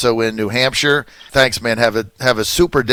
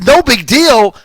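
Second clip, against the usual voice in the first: much higher and louder, scornful disbelief; incredulous outburst; 10 semitones higher, 7 dB louder, slower than usual. Real, not acted. Host scoffing at Bannon's reply to listeners, loud incredulous scorn. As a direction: throw the phrase back, outraged.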